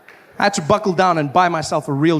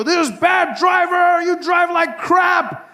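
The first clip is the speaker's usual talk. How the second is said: mock road rage; much slower than usual. Yelling at a swerving driver on the freeway, mock road rage. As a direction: scream it at the swerving car. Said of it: "and I go".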